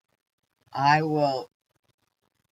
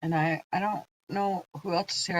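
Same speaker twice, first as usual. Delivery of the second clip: usual speaking voice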